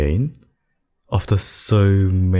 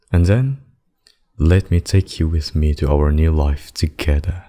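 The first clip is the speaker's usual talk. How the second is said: ordinary talk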